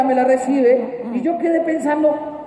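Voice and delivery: usual speaking voice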